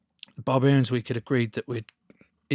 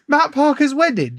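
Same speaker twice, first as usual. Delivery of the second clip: high, loud shocked question; retold yelp of disbelief; incredulous; 14 semitones higher, 6 dB louder than usual. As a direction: echo it back, aghast. Retold shock at wedding news.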